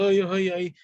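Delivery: high, loud mock whimper of a scared believer. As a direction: imitate someone nearly crying, hammed-up. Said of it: "casi llorando".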